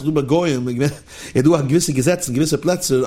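ordinary talk, nothing marked

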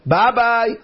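high, tossed-off farewell; hurried goodbye. Acted. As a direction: brush them off with a high goodbye. Hurried man takes his leave.

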